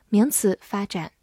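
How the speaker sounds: ordinary delivery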